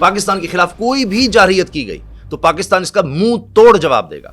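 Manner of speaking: high, loud newsreader delivery; dramatic news anchor tone; forceful news read. Shown as a demonstration. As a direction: read it like a fiery bulletin. Newscaster demoing his news read.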